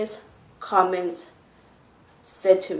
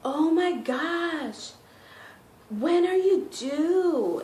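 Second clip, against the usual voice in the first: high, very slow, gushing mock voice; drawn-out feminine mock; lilting high coworker impression; imitating the guy; 8 semitones higher, much slower than usual. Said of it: "he's like".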